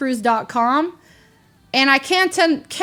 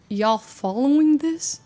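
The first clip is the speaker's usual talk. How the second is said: confused aside; 3 semitones higher, slower than usual. Retold or imitated. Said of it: "I'm like".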